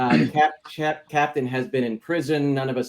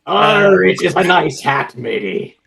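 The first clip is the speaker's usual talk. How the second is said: put-on pirate accent; 3 semitones higher, 8 dB louder than usual. Players mimic the pirate talk.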